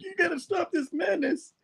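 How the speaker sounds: high squeaky falsetto; comic character begging to halt things; hammed-up high-pitched pleading; mock heroic plea. Peter's plea, acted in silly voice.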